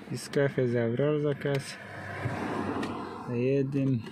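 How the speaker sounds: ordinary talk, nothing marked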